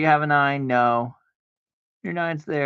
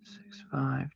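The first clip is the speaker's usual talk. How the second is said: whispered; 12 dB quieter than usual. Shown as a demonstration. Whispering the card numbers while dealing to himself. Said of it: "Six, five (said plainly first)".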